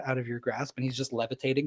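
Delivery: ordinary delivery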